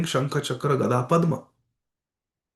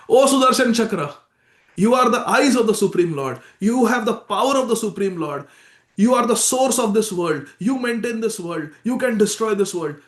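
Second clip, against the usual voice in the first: a raised, louder fervent invocation; fervent prayer; 6 semitones higher, 4 dB louder than usual. Retold or imitated. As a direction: call out to the divine weapon in praise, higher. Ambarish prays to the Sudarshan Chakra.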